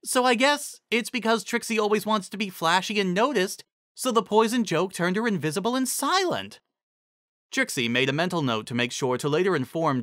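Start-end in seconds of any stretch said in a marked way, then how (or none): 0.0-6.6 higher pitched mare working it out; a bright pony piecing it together; lightly voiced pony mare. A narrator's reading. Cheerilee reasons out why Trixie vanished, lightly voiced mare.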